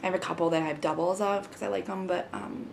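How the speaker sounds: ordinary talk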